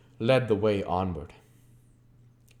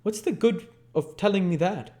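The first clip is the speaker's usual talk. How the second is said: higher, scornful snap; gruff retort; 11 semitones higher than usual. Acted. Sikes snaps back at Nancy, scornful. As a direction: scoff at her.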